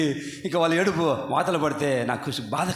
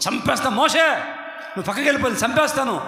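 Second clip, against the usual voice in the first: high shouted threat; angry God; 7 semitones higher than usual. Acted. God threatening Moses over the people, angry shout. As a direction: roar it like an enraged God. God's anger voiced in story.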